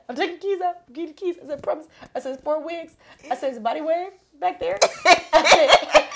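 high and loud, laughing through each promise; giggling panic retold; laughing frantic listing. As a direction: rattle off the wigs while cracking up. Frantic promises about wigs.

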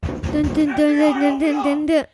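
chanted suspense sting; dramatic dun dun dun. Pretend. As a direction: hum a dramatic reveal. Dramatic sting sound effect.

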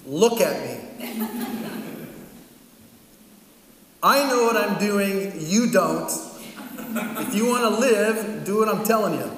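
pastor sternly warning Mark so he stays alive. Retold or imitated. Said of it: "made me look him straight in the eyes".

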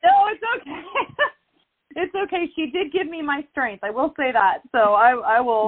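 fighting the tears with a shaky laugh; holding back tears. Real, not acted. Guest moved by the host's words, tearful laugh. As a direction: voice breaking high, laughing through tears. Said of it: "I'm trying not to cry".

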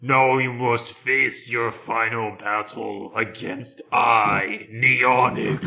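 booming, slow evil villain; booming echoing villain monologue. Pretend. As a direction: declare the final battle like a video game villain. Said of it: "sounds like a villain on Tron".

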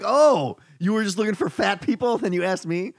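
high, indignant complaint; offended guest. Pretend. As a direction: accuse them like a hurt guest. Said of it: "be like".

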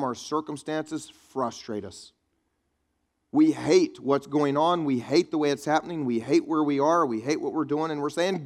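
ordinary talk, nothing marked

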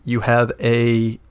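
ordinary talk, nothing marked

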